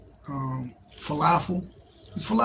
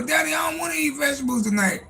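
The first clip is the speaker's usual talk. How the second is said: put-on much higher shouted kid's protest; whiny daughter; 9 semitones higher than usual. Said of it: "You know".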